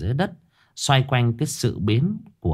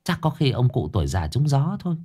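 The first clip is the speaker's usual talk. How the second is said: a lightly voiced, higher old gossip; gossiping neighbor; 7 semitones higher than usual. A narrator's reading. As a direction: insist you are right. Another neighbor arguing.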